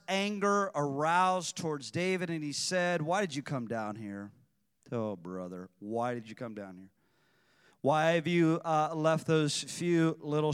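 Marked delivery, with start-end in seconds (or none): 3.0-6.9 put-on lower, a lightly sneering older brother; a hint of the scornful big brother; condescending. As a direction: talk down to the little brother. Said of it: "Are you seeing the condescension?".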